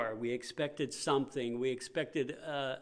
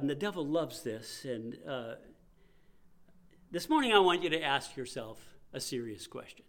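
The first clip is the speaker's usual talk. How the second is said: normal talking voice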